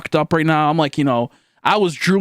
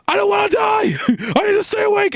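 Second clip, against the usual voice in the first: screaming. Retold or imitated. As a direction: scream it like you're terrified of dying. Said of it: "at the top of my lungs".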